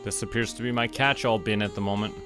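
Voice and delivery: ordinary delivery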